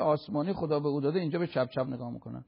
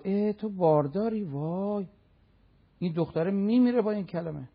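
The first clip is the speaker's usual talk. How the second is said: higher-pitched scandalized busybody; mock reproach; 4 semitones higher than usual. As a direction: act out a shocked, judging onlooker. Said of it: "یه نگاه ملامت".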